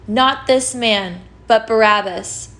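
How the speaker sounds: ordinary talk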